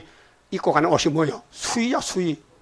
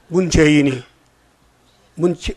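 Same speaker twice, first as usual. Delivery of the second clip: ordinary talk, nothing marked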